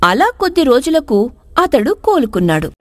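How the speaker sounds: ordinary delivery, nothing marked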